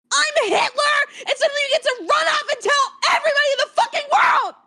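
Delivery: sobbing, furious yelling; crying shout. Real, not acted. Girlfriend yelling at him through tears, sobbing.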